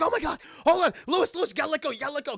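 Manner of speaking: high, loud panicked pleading; acted frantic urging. Anna tries to treat Lewis's neck, acted panic. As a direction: urge him in mock desperation. Wife frantic over wound.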